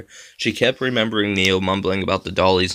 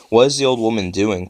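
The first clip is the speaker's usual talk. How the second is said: plain reading voice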